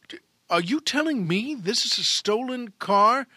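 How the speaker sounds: a policeman who can't believe his ears; shocked cop. Acted. Shocked cop questions the driver.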